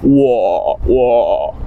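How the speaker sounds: "wo" sinking low and creaky; low third tone. Shown as a demonstration. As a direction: let "wo" fall and stay down. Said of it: "just goes down".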